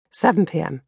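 plain reading voice

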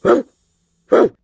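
short, sharp barks; loud dog bark. Acted. Buddy the dog calling the girls, loud barking. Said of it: "Buddy barked loudly".

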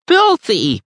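high, loud disgusted voice; shrill old neighbour. A narrator's reading. Angry neighbour complaining to Krieger, high and loud. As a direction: spit the word out in disgust.

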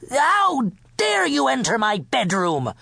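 high-pitched royal indignation; outraged cry. A narrator's reading. King caught in bed with candy, outraged cry. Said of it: "cried the king".

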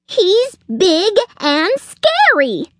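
slow, high squirrel wail; alarmed. A narrator's reading. Squirrel explaining fear of the dog, alarmed. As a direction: draw out each word in high dread.

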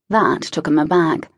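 plain reading voice

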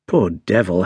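ordinary delivery, nothing marked

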